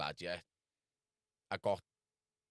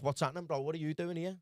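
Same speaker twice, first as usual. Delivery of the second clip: retelling his own greeting; 7 semitones higher than usual. Retold or imitated. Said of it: "and I'm like".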